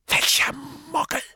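cutting, high hissing demand; sharp. Acted. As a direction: snap the question. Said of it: "sagte Riddle scharf".

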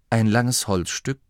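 usual speaking voice

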